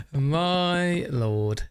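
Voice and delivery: normal talking voice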